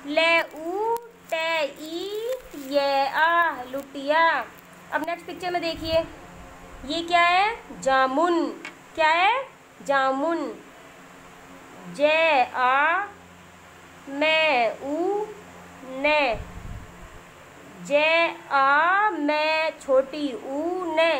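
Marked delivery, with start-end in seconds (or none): 0.1-3.5 slow letter-by-letter dictation with pauses; spelling out slowly. Shown as a demonstration. Teacher spelling a word for young children, slow dictation. 12.0-21.1 slow letter-by-letter dictation with pauses; spelling out slowly. Shown as a demonstration. Teacher spelling a word for young children, slow dictation.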